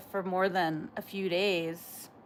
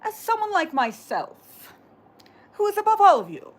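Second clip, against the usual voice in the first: high, loud mock rich-English accent; old English person; 11 semitones higher, 9 dB louder than usual. Shown as a demonstration. Streamer showing viewers how old English people talk, mock English accent. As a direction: put on a haughty, hammed-up old English voice. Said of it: "They talk just like that".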